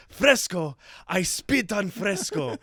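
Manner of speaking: high, drawn-out disdain; hammed-up Italian artist's contempt; campy Italian scorn. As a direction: spit it out like an offended diva.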